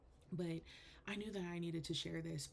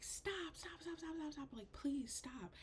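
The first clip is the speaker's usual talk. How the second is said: very high, thin, breathless pleading; retold freaking out; 11 semitones higher than usual. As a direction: rattle off stops, then drag out the plea. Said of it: "I'm freaking out".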